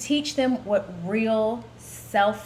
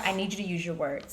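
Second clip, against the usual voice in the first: imitating firm mom; 3 semitones lower, 10 dB quieter than usual. Telling baby daughter.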